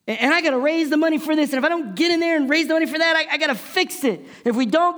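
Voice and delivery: high, fast, loud frantic self-talk; panicked racing thoughts. Retold or imitated. Pastor replaying his anxious thoughts, pitched high and rushed. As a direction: spiral into panic, words tumbling out. Frantic inner monologue under pressure.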